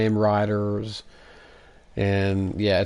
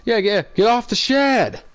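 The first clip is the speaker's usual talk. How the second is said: high-pitched shout at a neighbor; high yell; 13 semitones higher, 5 dB louder than usual. Retold or imitated. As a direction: scream it high and loud. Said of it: "He did the".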